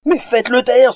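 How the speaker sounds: loud, high desperate plea; begging ogre. Acted. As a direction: beg in despair. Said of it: "supplia l'imposant meunier".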